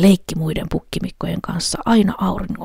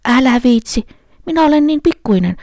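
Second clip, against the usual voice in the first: high, louder tiny goat plea; little goat pleading; 8 semitones higher, 5 dB louder than usual. A narrator's reading. Little goat begging the troll, high and small. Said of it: "sanoi pukki".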